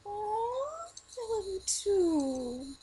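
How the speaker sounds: high, slow, drawn-out coo; exaggerated high sweet-talk to a child; retold cooing. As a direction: coo it to a little kid. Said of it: "And I'm like".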